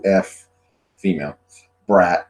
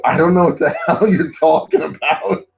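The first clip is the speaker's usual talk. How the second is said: higher, exasperated bewilderment; retold bafflement; 7 semitones higher, 3 dB louder than usual. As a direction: protest through a laugh. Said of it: "I go".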